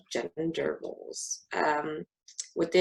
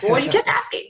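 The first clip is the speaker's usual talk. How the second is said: high, loud mock-exasperated comeback; exasperated retort; 10 semitones higher, 7 dB louder, faster than usual. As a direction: toss it back, fed up. Retorting to nosy strangers.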